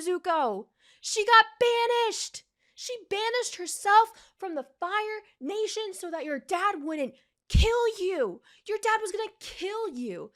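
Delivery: high, loud indignant scolding; outraged rant. Real, not acted. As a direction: scold him as if he were here. Ranting at Zuko.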